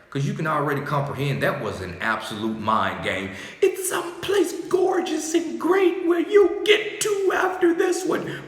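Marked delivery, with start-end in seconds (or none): 3.6-8.4 very high shout. Acted. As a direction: burst into a very high, loud shout of promise. Voiced promise of a gorgeous afterlife.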